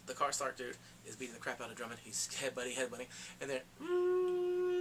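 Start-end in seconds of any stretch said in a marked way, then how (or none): 3.4-4.8 long hum. Real, not acted.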